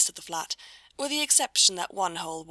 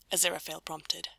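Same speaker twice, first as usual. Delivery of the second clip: plain reading voice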